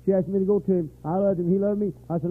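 ordinary talk

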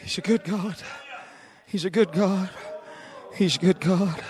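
quiet, low murmured affirmation; gentle afterglow of praise; soft repeat. Acted. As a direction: bring it down to a hush. Soft echo after the shouting.

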